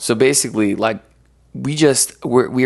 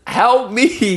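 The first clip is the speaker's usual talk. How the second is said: pleading cry; 10 semitones higher than usual. Retold or imitated. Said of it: "screaming at God in a help me kind of way".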